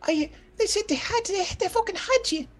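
pleading, very high falsetto; high female character voice. Acted. Woman swears to her husband she protected him, high falsetto. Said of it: "she talk like that".

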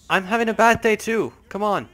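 high, loud protest; indignant retort. Real, not acted. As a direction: yell back at them in exasperation. Arguing back at game characters.

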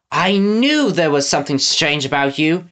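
ordinary delivery, nothing marked

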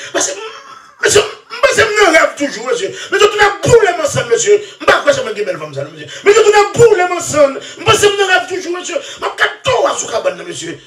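high, laughing wail of the imitated woman; mock woman's wail. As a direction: ham up her excuses in a high sob-laugh. (vwa fanm k ap rele an ri)

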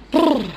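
a high, loud shivering brrr; shiver sound. Real, not acted. Owner shivering in the morning, loud high brrr. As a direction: shake off the chill with a loud brrr.

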